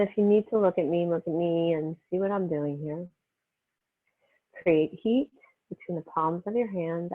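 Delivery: calm, unhurried meditation voice with long pauses; guided meditation. Real, not acted. Yoga teacher easing students into breath and healing energy, guided meditation. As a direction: settle them gently into the breath, phrase by phrase.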